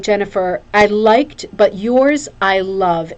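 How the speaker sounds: usual speaking voice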